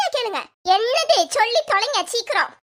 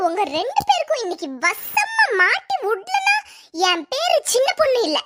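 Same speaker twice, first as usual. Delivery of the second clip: ordinary delivery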